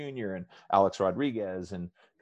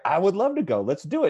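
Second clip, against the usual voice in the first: high, loud, thrilled acceptance; eager yes; 11 semitones higher, 10 dB louder than usual. Retold or imitated. Guest retells accepting coworker's party invite, thrilled. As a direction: gush with delight at the invite.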